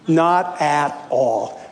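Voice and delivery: ordinary talk, nothing marked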